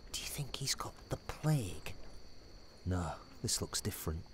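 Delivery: worried whisper; fearful hushed whisper. Acted. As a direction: whisper the fear. Hushed worry at night.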